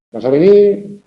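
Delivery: vas a venir rising high on venir; question intonation. Shown as a demonstration. Teacher showing how intonation separates sentences, rising tune on vas a venir. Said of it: "Eso nos permite distinguir unas frases de otras".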